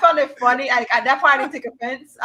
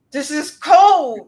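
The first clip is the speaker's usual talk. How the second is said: drawn-out, louder protest; slow cold complaint; 3 semitones higher, 8 dB louder, much slower than usual. Retold or imitated. Complaining to the technician about the icy jelly, slow and louder. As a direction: stretch each word out in shock. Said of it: "I was like".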